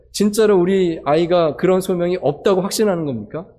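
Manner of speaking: ordinary talk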